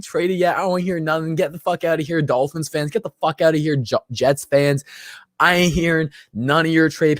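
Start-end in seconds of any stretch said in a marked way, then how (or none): none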